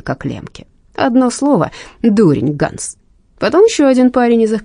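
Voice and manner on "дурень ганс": ordinary delivery, nothing marked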